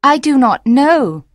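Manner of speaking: high, loud, urgent plea; pleading mermaid. Acted. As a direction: cry out the promise, high. Mermaid voiced in story.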